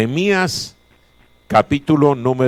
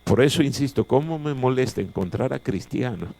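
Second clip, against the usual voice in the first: ordinary delivery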